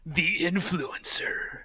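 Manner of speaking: slow, hushed mock announcement; dramatic whisper. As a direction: whisper it like an ominous wrestling name. Hushed echo of the wrestling name.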